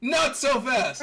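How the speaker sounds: high, loud, stretched-out call; hammed-up heroic interception; booming halt. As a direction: call out to stop a getaway. Armstrong grabs the fleeing Silver.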